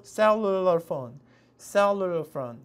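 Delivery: cellular phone stretched very slowly, stress on cel; slow model pronunciation. Shown as a demonstration. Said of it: "이렇게 cellular phone 이라고 발음하고요". (cellular phone 천천히 또박또박)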